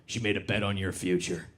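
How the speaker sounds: lightly voiced tough-guy caption; mock grim motivational voice. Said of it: "posted an Instagram that said".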